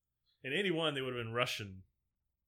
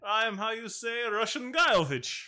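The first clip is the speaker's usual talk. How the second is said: slow, much higher and louder hammed-up Russian accent; mock Russian accent; 9 semitones higher, 8 dB louder, slower than usual. Cohost tops the joke, loud slow hammed-up Russian accent. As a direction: ham it up as a cartoon Russian.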